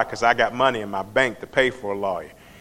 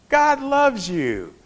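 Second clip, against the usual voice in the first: slow, high, drawn-out mocking preacher voice; hammed-up self-righteous tone, stretched out; lightly voiced smug friend; mock judgmental friend; 11 semitones higher, much slower than usual. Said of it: "So he's like".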